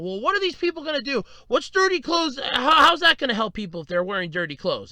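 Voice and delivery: high-pitched, fretful imitation of a grandfather; freaking-out grandfather. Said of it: "he starts freaking out".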